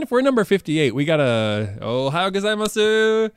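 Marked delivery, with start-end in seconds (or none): 1.8-3.3 "Ohayou gozaimasu" said slowly and high; "Ohayou gozaimasu" carefully spelled out; Japanese greeting. Shown as a demonstration. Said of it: "throw some Japanese in".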